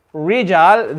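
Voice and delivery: "Rijal" with a curled English R, no trill. Shown as a demonstration. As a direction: drop the tongue shiver in "Rijal". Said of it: "we have not pronounced this quality".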